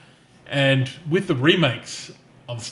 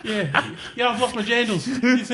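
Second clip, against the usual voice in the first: higher hammed-up Kiwi accent; New Zealand accent; 8 semitones higher, 3 dB quieter than usual. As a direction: play a laid-back Kiwi bloke. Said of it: "horrible New Zealand voice".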